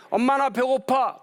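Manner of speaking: put-on high, slow little-kid whine; whiny child. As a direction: plead like a hungry small child. Child asking mother for food.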